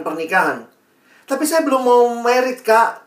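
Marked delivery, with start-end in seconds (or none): 1.2-3.1 lightly voiced young objector, higher pitch; a higher, louder protest to an older brother; young person objecting. Teen objects to mentor about getting married, lightly mock protest.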